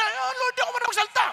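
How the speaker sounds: high-pitched mock excuse; Moses. Reluctant prophet excuses himself to the Lord, high protesting plea. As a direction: imitate someone pleading out of a task. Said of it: "Si Moses".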